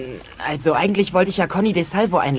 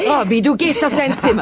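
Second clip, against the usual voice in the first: stern order; 8 semitones higher than usual. Acted. Mother sends son to his room.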